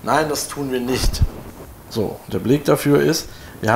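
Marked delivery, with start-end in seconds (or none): none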